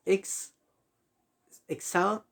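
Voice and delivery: "exhausto" built in pieces, ex... exa... usto, with long pauses; broken into syllables. Shown as a demonstration. Teacher separating syllables for students, "exhausto" in slow pieces. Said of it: "Tenemos ese tipo de separación".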